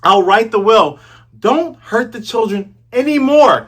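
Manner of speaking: high, loud desperate plea; pleading Kaya. Retold or imitated. Kaya begging Django to spare the children, high loud plea. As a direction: beg him to stop, voice up high. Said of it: "Kaya's like".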